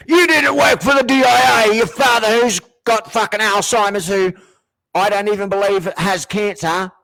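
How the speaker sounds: high, loud ranting parody voice; mocking the mother. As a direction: sneer at her as a shrill accuser. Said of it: "this is the same mother who was like".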